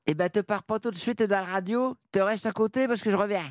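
radio teaser in a light ch'ti accent; host asks for the radio teaser in ch'ti, mild northern accent. Shown as a demonstration. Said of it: "le teasing en ch'ti".

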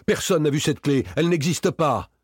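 high, heated protest; agitated high-pitched rebuttal; angry denial. A narrator's reading. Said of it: "gronda Victor".

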